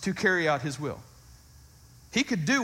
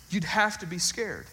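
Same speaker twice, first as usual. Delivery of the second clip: ordinary talk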